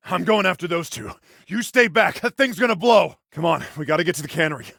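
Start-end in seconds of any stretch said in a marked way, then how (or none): 1.5-3.2 high, raised urgent warning; shouted warning. Acted. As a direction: yell them back from the danger. Warns brother of an explosion.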